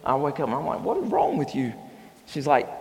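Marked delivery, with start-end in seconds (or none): 0.8-1.7 groggy annoyed retort. Retold or imitated. Woken by wife hitting him.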